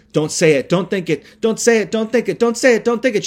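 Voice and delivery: high, frantic chant building in pitch; mock screaming. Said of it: "She starts, like, screaming".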